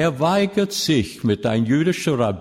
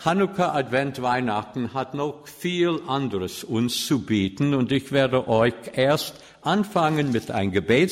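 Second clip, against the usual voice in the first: ordinary talk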